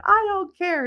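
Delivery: high mock-angry dismissal; retold high-pitched protest; indignant comeback. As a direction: imitate a son refusing to hear it. Voicing son's indignant comeback.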